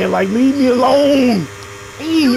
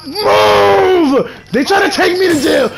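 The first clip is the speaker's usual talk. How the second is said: higher, loud yell; fleeing shout; 6 semitones higher, 4 dB louder, slower than usual. Real, not acted. A player fleeing police, shouting. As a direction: shout while running.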